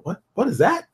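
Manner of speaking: amazed gasp of a question. Retold or imitated. Viewer stunned by a great trailer, high amazed question. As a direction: ask it like you can't believe your eyes. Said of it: "once in a while you go".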